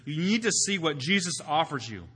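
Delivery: ordinary talk, nothing marked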